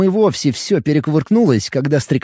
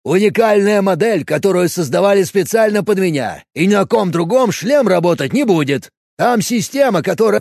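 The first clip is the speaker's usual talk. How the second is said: eager protest; 5 semitones higher than usual. Acted. Bob keeps protesting.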